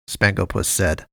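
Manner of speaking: ordinary delivery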